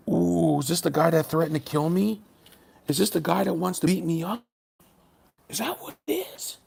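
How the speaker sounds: sarcastic scaredy voice, rising to a squeak; mock fear. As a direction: pretend to be terrified of him. Pretending to fear the commenter.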